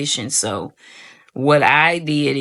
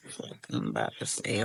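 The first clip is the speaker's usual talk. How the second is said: much softer whispered dread; 14 dB quieter than usual. Retold or imitated. Opening the score notification, whispering in dread. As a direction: whisper the fear to yourself.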